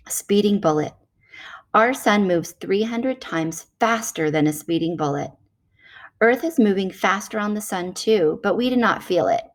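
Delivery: normal talking voice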